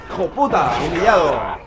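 furious yell at the screen; angry shout. Real, not acted. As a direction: curse it out loud. Gamer raging at the game.

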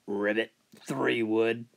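low, soft croaking frog. Acted. Golfer hears a frog on the green, low soft frog voice. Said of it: "I said".

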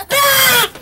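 high, loud yelp of mock pain; pretend cry out. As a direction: cry out as if stung. Bee stings again.

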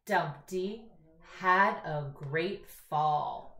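very slow, word by word reading; slow read-aloud. Shown as a demonstration. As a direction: drag out "Dumpty sat on a wall" for little readers.

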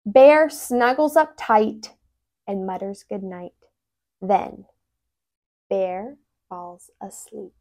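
plain reading voice

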